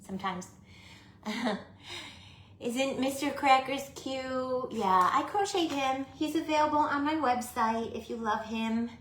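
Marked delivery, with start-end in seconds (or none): 2.6-8.9 doting voice. Real, not acted. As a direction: gush over a cute toy. Showing off her crocheted doll.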